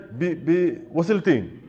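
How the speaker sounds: usual speaking voice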